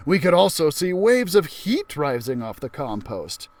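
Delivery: ordinary delivery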